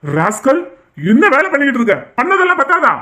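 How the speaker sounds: father bellowing at his son in fury; furious shout. Acted. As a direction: roar at the son in rage. Father scolds and strikes son.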